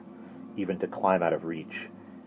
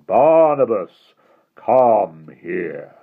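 slow, mild hint of a hypnotic summons; hypnotic monotone; 2 semitones higher, 10 dB louder, much slower than usual. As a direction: lull him toward you, slow and even. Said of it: "The voice dropped lower to a hypnotic monotone".

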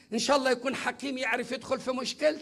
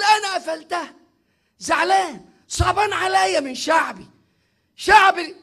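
higher, louder grieved divine voice; voicing God grieving; 5 semitones higher, 6 dB louder than usual. Preacher voicing God, sorrowful over his people, raised. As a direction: speak as the Lord, hurt by his people. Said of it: "فيقول".

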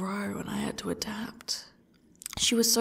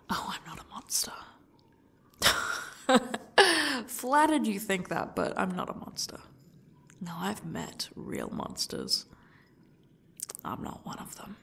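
dark whisper. Acted. Captor denying being a monster.

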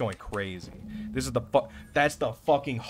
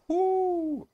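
very high, held "woo"; excited whoop. Real, not acted. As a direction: hold one high, thrilled whoop. Whoops at the gun barrel.

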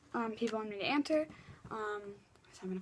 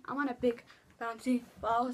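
normal talking voice

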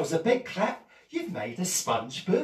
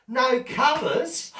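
mock surprise; 8 semitones higher, 6 dB louder, much slower than usual.

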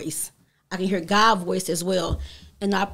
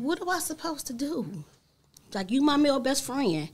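soft, high-pitched plea; small, raised voice, retelling a plea to her father; retold plea; 5 semitones higher, 4 dB quieter than usual. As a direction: quietly plead as if losing your best friend.

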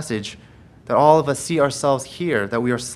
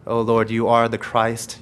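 prayer; 5 semitones lower than usual. Acted. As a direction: murmur it as a humble prayer.

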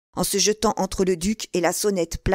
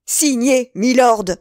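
loud, raised command; forceful demand; 5 semitones higher, 7 dB louder, slower than usual. A narrator's reading. Felton demands the duke's signature, loud and forceful. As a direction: order him to sign.

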